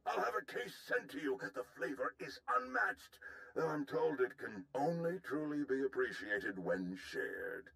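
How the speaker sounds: lilting, sing-song alien officer voice; a fussy alien general who loves his tea. Acted. Alien general's high chirpy voice.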